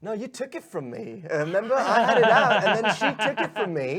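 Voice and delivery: high, loud, half-laughing complaint; laughing protest. Acted. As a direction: whine it through laughter. Player protests in character.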